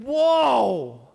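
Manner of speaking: drawn-out, taken-aback whoa; shocked reaction. Acted. Reader reacting to Jesus clearing the temple, taken aback. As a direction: draw out a stunned whoa.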